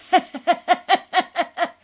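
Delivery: high, loud mock laugh with a frozen face; imitating Michaela's laugh. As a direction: parody a stiff, creepy laugh. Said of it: "she goes".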